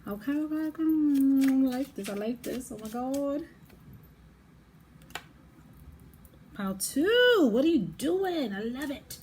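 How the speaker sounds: giddy, high-pitched delight; high sing-out of excited approval. Real, not acted. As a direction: squeal it, thrilled for the listener. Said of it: "I'm getting excited for you".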